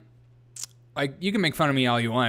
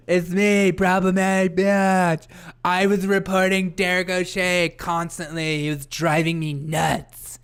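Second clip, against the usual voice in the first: higher, slow, drawn-out announcement; hammed-up slow, raised voice; put-on character voice; 4 semitones higher, 3 dB louder, slower than usual. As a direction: play a chatter making a grand entrance. Playing the viewer "problematic bitch.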